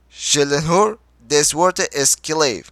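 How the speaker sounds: "Je l'honore des votre esclave" slowly, each word weighted; emphatic, word by word. A narrator's reading. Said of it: "Her kelimenin üzerine basa basa". ("Je l'honore des votre esclave", ağır ağır, basa basa)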